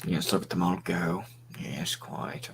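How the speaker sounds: low, soft, proper English gentleman; Sherlock Holmes voice. Acted. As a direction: play the polite English butler, hushed. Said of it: "What's with the Sherlock Holmes shit?".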